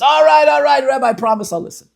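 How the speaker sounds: high, shouted frantic surrender; desperate giving in. Retold or imitated. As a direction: act out a man caving in loudly. Worked-up man finally gives in.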